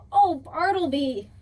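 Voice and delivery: loud, high cry of dismay; exasperated exclamation. A narrator's reading. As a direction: call out in fond exasperation. Exclaiming at the dog's calamity.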